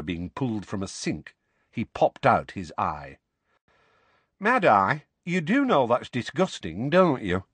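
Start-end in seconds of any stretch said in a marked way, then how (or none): 4.4-7.4 conversationally. A narrator's reading. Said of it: "said Tonks conversationally".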